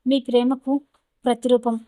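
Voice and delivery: plain reading voice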